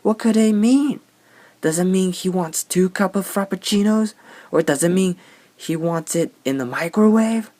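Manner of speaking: high, loud ditzy mock voice; mocking the barista. Mocking the confused barista, high, loud and ditzy. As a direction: play dumb in a silly high voice. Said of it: "you don't go like".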